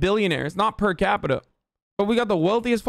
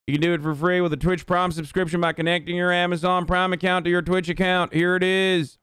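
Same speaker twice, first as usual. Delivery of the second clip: put-on monotone Southern accent, held on one raised note; Dale Gribble. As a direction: read the ad break in a droning Texas accent. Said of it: "a very specific Southern accent, a Dale Gribble accent".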